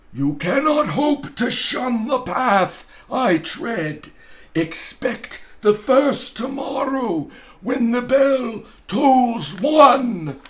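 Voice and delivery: loud, high, slow spectral wail; booming ghost. Acted. Marley's ghost warning Scrooge, loud and wailing. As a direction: proclaim it like a doomed ghost.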